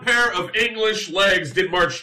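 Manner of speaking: high, grand mock-royal declamation; hammed-up royal speech. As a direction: declaim it like a pompous king. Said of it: "I thought upon".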